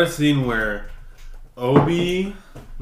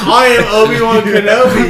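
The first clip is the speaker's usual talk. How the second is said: laughing Obi-Wan; 8 semitones higher, 10 dB louder than usual. Acted. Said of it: "He said".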